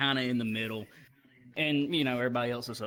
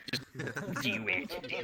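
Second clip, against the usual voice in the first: low, quiet sinister urging; Palpatine; 3 semitones lower, 8 dB quieter, slower than usual. Retold or imitated. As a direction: imitate an evil tempter's low coaxing. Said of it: "This guy's like fucking Palpatine".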